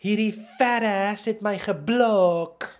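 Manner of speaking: high, drawn-out loud yell in Afrikaans; as loud as possible. Retold or imitated. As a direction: imitate a woman loudly complaining to her boyfriend. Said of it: "As loudly as possible".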